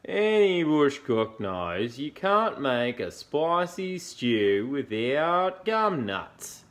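slow, loud, stretched-out koala voice; yawning koala. A narrator's reading. Koala lectures Dingo on gum nuts, yawning. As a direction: drag the words out as if mid-yawn. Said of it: "he yawned".

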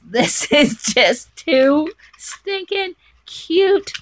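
loud, high, drawn-out gushing; gushing delight. Real, not acted. Gushing over a handmade gift of her name, loud delight. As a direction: stretch each word out in delight.

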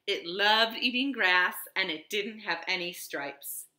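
ordinary delivery, nothing marked

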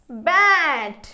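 "బ్యాట్" loud, long vowel, falling; pronunciation demo. Shown as a demonstration. Teacher modelling the blended word, "బ్యాట్" loud and drawn out. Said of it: "ఎలా పలుకుతుందో చూద్దాము".

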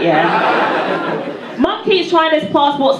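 ordinary talk

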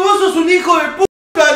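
loud, high angry outburst reliving the fight; retold furious insult. Retold angry confrontation with friend. (al amigo que confiesa, grito furioso y agudo)

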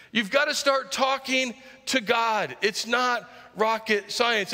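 drawn out, each word weighted; slow emphatic preaching. Acted. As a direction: spell it out slowly to the crowd.

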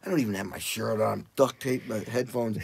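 ordinary delivery

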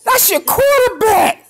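very slow, high drawl; high amazed drawl; awed exclaim. Real, not acted. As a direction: stretch out the awe. Awed at touchdown.

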